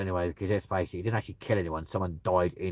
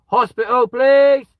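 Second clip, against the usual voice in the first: high, loud hammed-up cry for help; mock panic; 13 dB louder, slower than usual. Imagining an allergic reaction, shrieking for a hospital, hammed-up. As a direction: yell it like your throat is closing. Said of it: "I'll be like".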